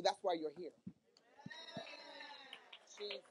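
usual speaking voice

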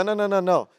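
normal talking voice

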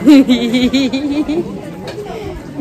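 a burst of embarrassed laughter; long giggle falling away. Real, not acted. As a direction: laugh off the teasing. Laughing at sister's teasing.

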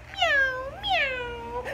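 high little kitten meows; small high mewing kitten. A narrator's reading. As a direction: mew like a tiny kitten. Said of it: "She could only go".

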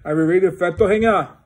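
loud call across the room. Real, not acted. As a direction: call out to someone nearby. Calling out to someone.

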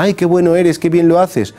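high, admiring mock compliment; admiring flatterer. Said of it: "la gente nos pida razón y nos diga".

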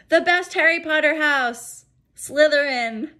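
loud, high teasing announcement; playful proclamation. Real, not acted. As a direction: proclaim it with mischief. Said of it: "playful teacher sign-off".

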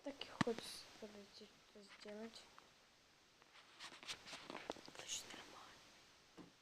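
whispered aside, fast then slowing. Real, not acted. Girl whispering an aside to viewers. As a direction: hush it so nobody hears.

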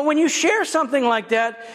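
ordinary talk

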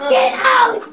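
full shout. Pretend. Host yells at the contestant to get out. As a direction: throw them off the stage.

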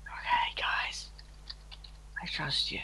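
secret whisper to viewers. Real, not acted. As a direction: whisper it so no one hears. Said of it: "Don't tell Aaron".

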